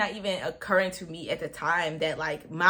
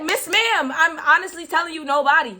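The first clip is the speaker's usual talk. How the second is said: much higher and louder pleading; pleading insistence; 6 semitones higher, 7 dB louder than usual. Retold or imitated. To the doctor, loud high pleading denial. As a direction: beg her to believe you.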